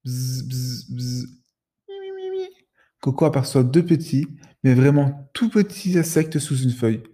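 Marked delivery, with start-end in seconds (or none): 0.0-1.3 soft buzzing bee sound; a small bee's buzz, softer. Acted. Little bee buzzing under a leaf, soft buzz. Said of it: "deux toutes petites voix". 1.9-2.5 very high, soft little squeak; tiny squeaky voice. Acted. Ladybird chirping under the leaf, very high squeak. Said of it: "deux toutes petites voix".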